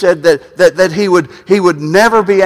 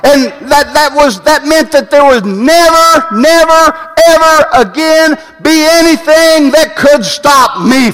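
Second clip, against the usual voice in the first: slow down and cry it out to the church; rising preaching shout; 7 semitones higher than usual. Real, not acted. Preacher to congregation, swelling into an excited shout. Preacher climaxing on the torn curtain.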